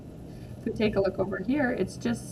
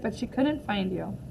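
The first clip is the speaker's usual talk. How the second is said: plain reading voice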